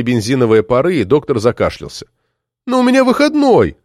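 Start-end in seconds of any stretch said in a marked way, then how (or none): 2.7-3.8 put-on high-pitched girl protesting; insistent plea. A narrator's reading. Marta repeats her plea to the doctor, high insistent protest. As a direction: protest in a high, sustained cry.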